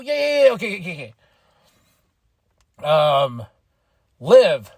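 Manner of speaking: ordinary talk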